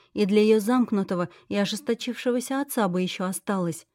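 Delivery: ordinary delivery, nothing marked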